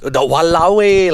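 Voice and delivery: slow, high Singlish exclamation. Shown as a demonstration. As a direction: drawl it in Singlish. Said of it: "I don't say".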